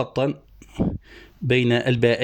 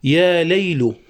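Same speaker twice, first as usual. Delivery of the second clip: raised, measured poetic recitation; reciting verse; 4 semitones higher, 4 dB louder, much slower than usual. Shown as a demonstration. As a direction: declaim the opening of the poem. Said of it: "قال الحصر القيرواني".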